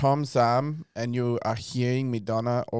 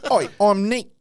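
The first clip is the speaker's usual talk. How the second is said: higher, louder mock Cockney greeting; 8 semitones higher, 4 dB louder than usual. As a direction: bark "Oi" like a London lad, half joking. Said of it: "What accent are you gonna do?".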